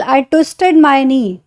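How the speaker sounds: drawn out, word by word; very slow example. Shown as a demonstration. Teacher reads example to class, very slowly. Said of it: "For example".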